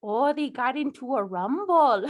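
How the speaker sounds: lightly played Midwestern mom accent. Mom hearing about the gang rumble, Midwestern accent. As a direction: react as a gossipy Midwestern mom. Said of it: "Midwestern mom".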